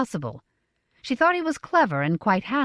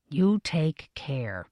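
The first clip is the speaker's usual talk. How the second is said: slow, grim warning; 3 semitones lower, much slower than usual. A narrator's reading. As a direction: warn her slowly and darkly.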